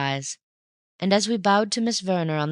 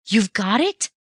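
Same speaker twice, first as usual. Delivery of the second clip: higher, louder breathless cry; excited cry; 6 semitones higher, 5 dB louder than usual. A narrator's reading. Bunny guessing about the pearl, higher and louder. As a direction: burst out with it. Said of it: "I cried".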